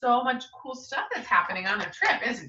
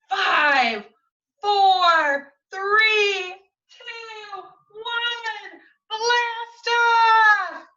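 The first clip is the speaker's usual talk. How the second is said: rising, louder countdown to blast off; excited countdown; 9 semitones higher, 6 dB louder, much slower than usual. Acted. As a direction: count down building to a shout.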